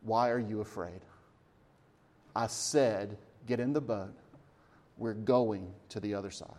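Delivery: soft, low words to frightened followers; gentle, low-pitched calm authority; calm reproof. Retold or imitated. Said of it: "he looked and said".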